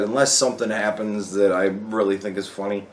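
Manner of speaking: ordinary talk, nothing marked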